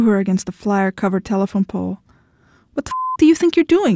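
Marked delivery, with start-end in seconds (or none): 2.7-4.0 high, sharp outraged demand; furious demand. A narrator's reading. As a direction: fling the question at him in fury. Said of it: "she demanded".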